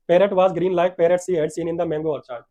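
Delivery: slow, emphatic English read-aloud; read-aloud story. A narrator's reading. Teacher reading the English lesson to the class, slow story reading. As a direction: read the lesson out loud and very slowly.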